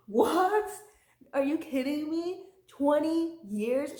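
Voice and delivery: loud, high outraged "what?"; shocked disbelief. Retold or imitated. Reviewer reliving her reaction to the age gap, appalled. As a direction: shriek it in disbelief, stretching twenty years. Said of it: "And I'm like".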